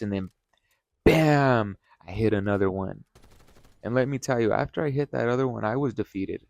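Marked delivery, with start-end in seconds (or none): 1.0-1.8 loud, high, punched-out bam; sudden impact exclamation. Retold or imitated. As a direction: burst out like a crash hitting. Mimics the impact of hitting another.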